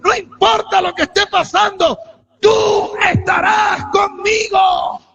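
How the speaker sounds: high, loud, drawn-out pulpit cry; full-force preaching. Real, not acted. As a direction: preach it at the top of your voice. (prédica a gritos, con toda la fuerza)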